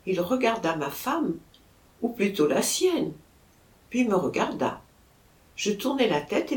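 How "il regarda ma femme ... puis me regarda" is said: ordinary delivery, nothing marked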